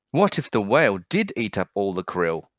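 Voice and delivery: ordinary delivery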